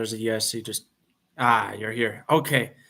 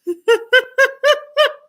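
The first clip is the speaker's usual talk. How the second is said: high loud laugh; 9 dB louder than usual. Real, not acted. Streamer cracking up at own accent, high loud laugh. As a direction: burst of high-pitched laughter.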